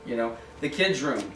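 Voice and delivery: normal talking voice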